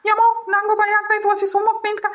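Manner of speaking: high-pitched, anxious fretting; high voice of someone getting scared; frightened. Real, not acted. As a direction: fret in a high, worried voice. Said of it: "ನನ್ಗೆ ಈಗ್ಲೇ ಭಯ ಆಗ್ತೈತಮ್ಮ".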